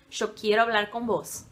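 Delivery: "yo" pronunciado "cho", tom mais grave; chiado argentino. Shown as a demonstration. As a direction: espanhol argentino com "yo" chiado. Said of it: "eles falam muito chiado". ("yo" com som de "ch", à argentina)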